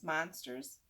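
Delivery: ordinary delivery, nothing marked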